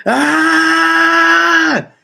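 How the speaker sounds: sudden high shrieking cry of alarm; a man losing it, letting out a piercing scream; panicked scream. Retold or imitated. Passenger snapping at the checkpoint, piercing scream. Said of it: "this man snaps and loses his mind".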